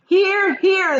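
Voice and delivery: high, loud chorus cheer; shouting. A narrator's reading. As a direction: agree at the top of your voice. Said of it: "they all shouted".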